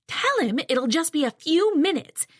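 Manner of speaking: impatient mother barking. Acted. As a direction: bark it down the phone as the mother. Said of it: "my mother barks".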